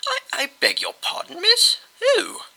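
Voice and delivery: high, flustered stammer; startled stammer. A narrator's reading. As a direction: caught off guard, stammering. Bastings startled by girl.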